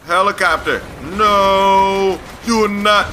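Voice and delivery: loud high outcry at the game; yelling. Real, not acted. Gamer yelling at the screen in sudden alarm. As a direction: shout in alarm as a helicopter appears.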